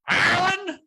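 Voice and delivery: put-on high, loud drunken Irish brogue; over-the-top Irish brogue. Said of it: "The brogue was too much".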